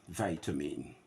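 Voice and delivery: "vitamin" with VITE, rhyming with bite; American vitamin. Shown as a demonstration. Teacher giving the General American form to students. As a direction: open "vitamin" with VIE. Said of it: "G A".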